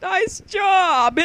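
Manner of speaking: high, loud cheer; self-praise shout. Real, not acted. Reader cheers her own French, loud high cheer. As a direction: cheer for yourself. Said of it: "praising herself".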